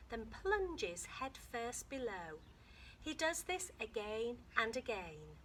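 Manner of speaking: lively read-aloud to a class, lifting the story lines; story reading to children. Acted. Teacher reading a dolphin book aloud to her class, lively storytelling. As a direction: read it to the kids like an adventure.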